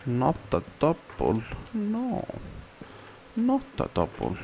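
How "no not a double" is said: high dismay. Real, not acted. Dismay at a wrong slab.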